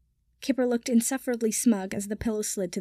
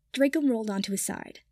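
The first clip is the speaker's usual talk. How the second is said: usual speaking voice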